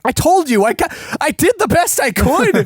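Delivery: high-pitched, frantic self-defense; hammed-up desperate protest; panicked Jackie impression. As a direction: plead your case in a high, squeezed voice. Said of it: "Jackie's just gonna be like".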